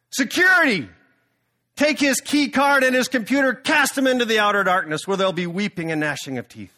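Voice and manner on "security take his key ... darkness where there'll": loud, high bark for the guards; angry shout. Acted. The CEO ordering the salty snack manager thrown out, loud and high.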